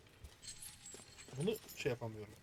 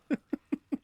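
high, louder burst of laughter; 12 semitones higher, 7 dB louder than usual. Real, not acted. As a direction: laugh out as it all explodes. Everything blows up. (yüksek sesle kahkaha)

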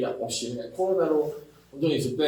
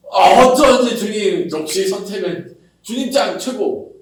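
louder, slow gushing praise; mock delighted praise; 4 semitones higher, 6 dB louder, slower than usual. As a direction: gush over the Lord's choice. 뒤늦게 감탄하는 교인. (크고 느리게 감탄하는 흉내)

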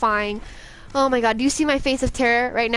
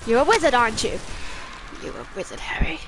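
mock film quote. Quoting Harry Potter for fun.

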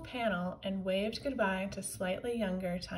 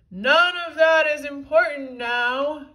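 loud booming commanding voice; commanding shout; 5 semitones higher, 12 dB louder than usual. A narrator's reading. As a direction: boom it out with authority. Giant Tippy commands.

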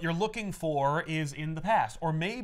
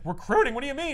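higher, puzzled questions; mock baffled Jameson; 8 semitones higher, 3 dB louder, faster than usual. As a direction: ask it genuinely confused.

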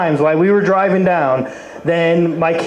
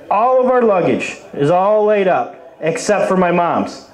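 ordinary talk

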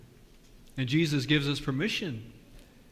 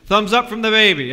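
loud and higher, cheerful call; 6 semitones higher, 11 dB louder than usual. Real, not acted. Pastor calling out to the crowd, loud and bright. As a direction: announce it to the whole room.

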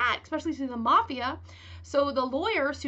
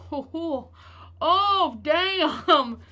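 startled laugh, louder; flustered laughing; 3 semitones higher, 7 dB louder, much slower than usual. Real, not acted. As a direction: laugh it off, caught out. Blurts out by accident, laughing.

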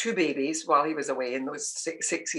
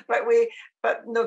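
ordinary talk

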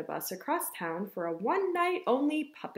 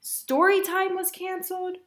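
high-pitched lament; exasperated; 8 semitones higher, 4 dB louder than usual. Acted. Mother recounts disasters to Ava, exasperated. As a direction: list it off in rising despair.

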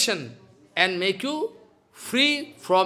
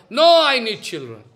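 louder, insistent no; loud refusal; 8 dB louder than usual. Retold or imitated. Atmadev to the sannyasi, loud and insistent. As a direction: refuse flatly and loudly.

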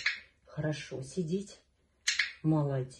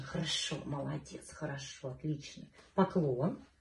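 ordinary talk, nothing marked

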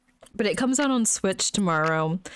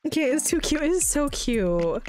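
gushing over cuteness; 6 semitones higher than usual. Real, not acted. Gushing over cute game.

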